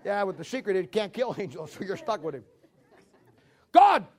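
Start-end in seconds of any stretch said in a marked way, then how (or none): 3.7-4.1 higher, louder, breathless urgent news; alarmed report. Retold or imitated. Angel reporting wicked giants to God, alarmed and loud. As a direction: rush the bad news to the boss.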